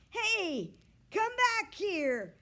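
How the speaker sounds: loud, high, slow call after the dog. A narrator's reading. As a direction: yell it across the yard. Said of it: "called Mister Dingley".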